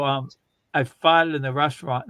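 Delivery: ordinary talk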